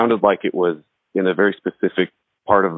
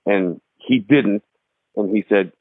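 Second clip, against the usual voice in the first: usual speaking voice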